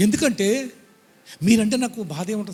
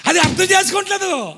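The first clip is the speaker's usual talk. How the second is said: anguished cry; 12 semitones higher, 6 dB louder than usual. Real, not acted. Preacher laments congregation.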